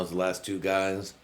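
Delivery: usual speaking voice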